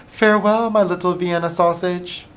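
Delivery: high fond call to her son; mother calling out. A narrator's reading. Mother waving Oscar off, high calling. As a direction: sing it out from the front yard. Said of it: "waving and calling out".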